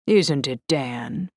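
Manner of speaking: ordinary delivery, nothing marked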